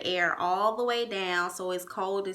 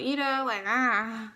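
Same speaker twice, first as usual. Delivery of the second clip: ordinary delivery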